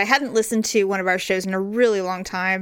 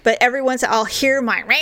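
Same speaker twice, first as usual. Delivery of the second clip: ordinary talk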